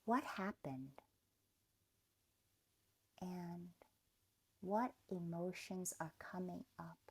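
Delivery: normal talking voice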